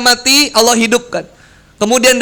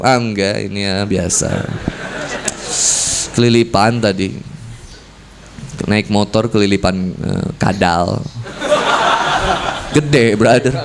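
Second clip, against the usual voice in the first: much lower, gruff mock-casual denial; pretending not to cry; 14 semitones lower, 4 dB quieter than usual. Man caught crying brushes it off, gruff and pretend casual. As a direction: play it cool, hiding the tears. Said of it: "pura-pura".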